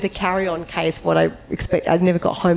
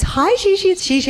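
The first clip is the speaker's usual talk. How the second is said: high, sing-song phone greeting; lightly voiced bubbly assistant; chirpy assistant; 13 semitones higher, 3 dB louder than usual. Assistant calling back with news, chirpy and high. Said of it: "and she goes".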